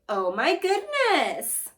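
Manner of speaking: slow, high, amazed oh my goodness; drawn-out oh my goodness. Shown as a demonstration. Teacher prompts class, slow amazed tone. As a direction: stretch out goodness in mock shock.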